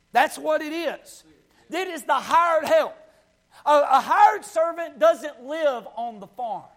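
urgent country-pulpit delivery; full-force preaching. Real, not acted. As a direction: preach it hard, line after line.

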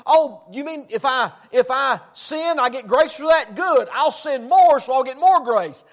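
loud, punchy, acted glee; mock-delighted sinner. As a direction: seize gladly on the loophole.

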